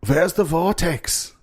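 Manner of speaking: high, slow mock-German cry; dramatic old German, drawn out; Scary German Guy. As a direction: call it out in a put-on German accent. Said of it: "and he goes".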